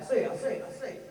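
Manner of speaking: blustering cartoon Southern repetition; mock an actor as a blustery cartoon rooster; Foghorn Leghorn. Comedian mocking an actor's accent, cartoonish Southern bluster. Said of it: "Kevin's, oh, Foghorn Leghorn".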